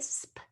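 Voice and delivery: "sp" as one short consonant blend; blended sound. Shown as a demonstration. Teacher demonstrating a consonant blend, sounded out. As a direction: sound out "sp" without naming the letters. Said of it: "consonant cluster or blend".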